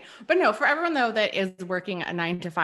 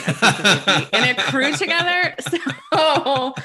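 talking through a laugh, louder; 5 semitones higher, 8 dB louder than usual. Real, not acted. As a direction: laugh while you say it. Joking about a bro crew.

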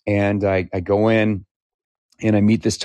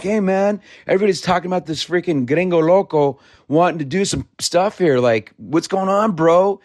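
lightly voiced easygoing bro, pitched up; chummy bro voice; 7 semitones higher than usual. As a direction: welcome him like a buddy, pitch lifted. Retold chummy welcome from Tomas.

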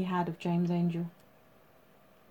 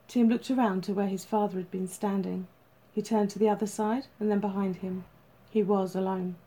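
usual speaking voice

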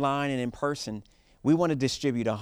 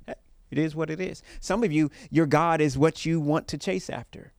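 preaching; 4 semitones higher, 4 dB louder than usual. Acted. Preaching at full force.

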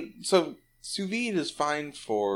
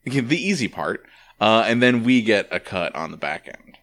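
podcast intro; 4 semitones lower, 5 dB louder than usual. Acted.

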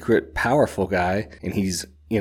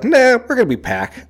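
playful wolf voice; 14 semitones higher, 6 dB louder than usual. Retold or imitated. Night Eyes voiced in retelling.

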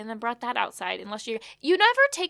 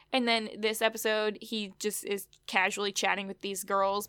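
ordinary delivery

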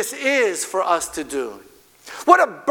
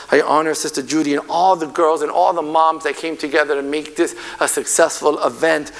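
usual speaking voice

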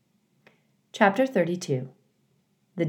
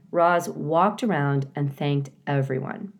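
plain reading voice

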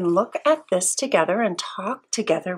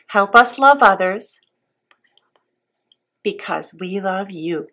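prayer. Real, not acted. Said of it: "I would like you to repeat after me".

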